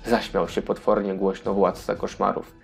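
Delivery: normal talking voice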